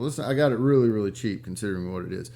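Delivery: ordinary delivery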